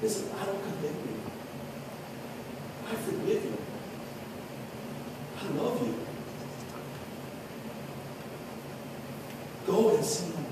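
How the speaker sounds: soft, half-whispered reassurance; preacher voicing Jesus to the woman, soft and tender; gentle forgiveness. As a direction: speak it gently as if to someone ashamed. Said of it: "that's a gentle spirit".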